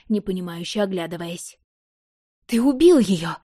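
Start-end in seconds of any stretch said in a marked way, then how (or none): 2.5-3.4 loud, high shocked cry; horrified exclamation. A narrator's reading. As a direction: cry out in horror. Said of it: "воскликнула Элария".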